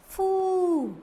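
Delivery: फू with a long drawn oo; long ū vowel; long oo sound. Shown as a demonstration. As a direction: stretch the oo in फू. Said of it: "फा पे हमने मात्रा लगा दी".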